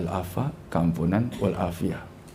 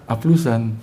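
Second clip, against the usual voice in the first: ordinary talk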